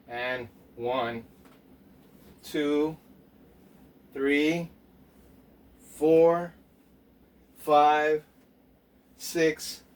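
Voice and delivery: steady, evenly spaced workout count; counting out reps. Real, not acted. Coach counting reps for viewers, measured count. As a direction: call each rep on the beat.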